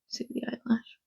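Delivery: whispering. Real, not acted. Spots eyelash, whispers to viewers.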